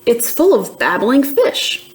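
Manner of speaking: ordinary delivery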